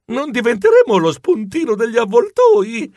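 high, anxious questioning; preoccupato. Acted. Narrator's mouse self worries about vultures. (voce acuta e ansiosa)

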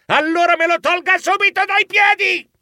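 very high, loud outburst; furious high-pitched shout; losing all calm. A narrator's reading. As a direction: explode in exasperation. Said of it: "con molta meno calma".

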